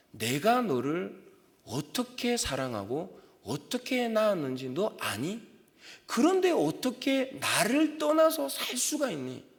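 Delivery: slow, high, aching plea of a loving father; tender pleading God. Acted. God voiced pleading with Eve.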